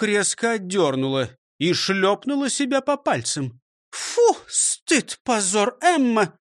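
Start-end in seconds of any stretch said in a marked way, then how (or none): 3.9-6.4 high, sharp mock self-reproach; self-scolding. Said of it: "сказала она самой себе". (сама себя стыдит)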